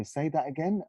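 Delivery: high, puzzled "say that again?"; puzzled question. Retold or imitated. Friends teasing, asking him to repeat. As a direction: friends mock-puzzled by his accent. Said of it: "used to kind of go".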